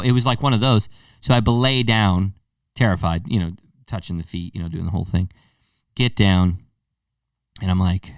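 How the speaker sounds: usual speaking voice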